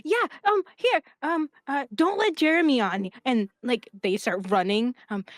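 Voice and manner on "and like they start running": usual speaking voice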